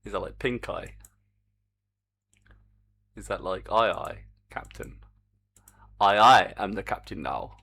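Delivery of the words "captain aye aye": ordinary talk, nothing marked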